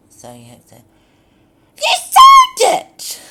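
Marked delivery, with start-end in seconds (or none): 1.8-3.2 very high, very loud shriek, falling on "sold". Shown as a demonstration. Demo of a line read as a shriek. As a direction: screech "You sold it!" with a fall. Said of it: "Like that".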